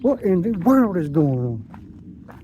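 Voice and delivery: slow, stretched-out wonder; slow amazed drawl. Real, not acted. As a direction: savor each word in disbelief. Amazed at the day.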